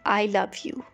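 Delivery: ordinary talk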